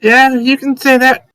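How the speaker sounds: character voice. Acted. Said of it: "We'll be like".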